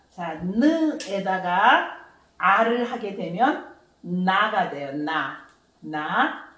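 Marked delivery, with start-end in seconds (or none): none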